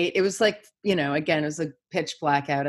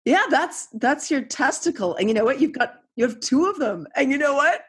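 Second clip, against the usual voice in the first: amused mom; 8 semitones higher than usual. Retold or imitated. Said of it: "I was like".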